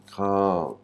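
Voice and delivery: "cran" held long and drawn out; demonstrated syllable sound. Shown as a demonstration. Teacher showing the sound the letters make, "cran" drawn out. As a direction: draw out "cran" as one slow syllable. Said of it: "ce qui fait le son".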